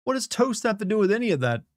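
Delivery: high-pitched, real bafflement; baffled. Real, not acted. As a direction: ask it high, utterly confused. Baffled reaction to donated message.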